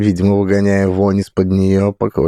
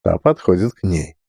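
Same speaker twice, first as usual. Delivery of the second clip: ordinary delivery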